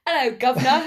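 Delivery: mock British. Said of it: "American who ... comes back from the U K".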